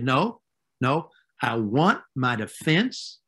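ordinary talk, nothing marked

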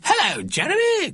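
very high sing-out greeting; high-pitched motherly hail; mother calling out. A narrator's reading. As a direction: call sweetly through a window. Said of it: "called Missus Smythe Fortescue through the open".

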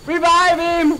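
high loud call; urgent shout. Real, not acted. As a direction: yell the order urgently.

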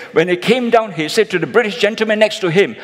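normal talking voice

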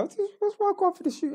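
high, fast mock of a rowdy guy; hammed-up high-pitched mimic of the friend; mimicking the uncle's friend. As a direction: squeak it out fast like the twitchy friend.

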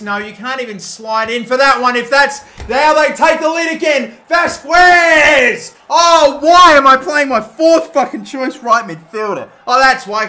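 loud, high, furious ranting at his own players; angry shout. Real, not acted. Gamer yelling at his useless right midfielder, furious shout. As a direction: yell at the screen as they concede again.

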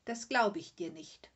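candid, firm contradiction; frank disbelief. A narrator's reading. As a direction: say it bluntly and openly. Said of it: "sagte die kleine Müh aufrichtig". (aufrichtig und direkt)

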